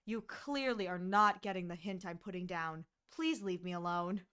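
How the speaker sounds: deadpan rejection; cold brush-off. Pretend. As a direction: shut him down coldly. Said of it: "It's like".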